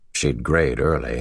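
usual speaking voice

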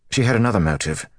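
mildly obstinate retort; stubborn. A narrator's reading. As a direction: dig in and refuse to yield. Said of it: "Gawain said stubbornly".

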